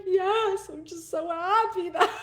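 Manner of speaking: high, loud voice laughing through happy tears; reenacting a tearful, laughing reply to her husband; happy sobbing. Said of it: "I'm sobbing".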